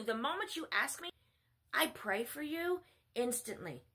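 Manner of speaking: ordinary delivery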